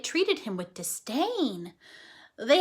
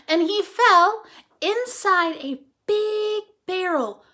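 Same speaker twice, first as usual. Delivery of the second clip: high, slow and louder, hamming the fall; dramatic telling; 6 semitones higher, 7 dB louder, slower than usual. A narrator's reading. Reading the superhero origin to the class, a slow dramatic build. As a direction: make the fall sound epic.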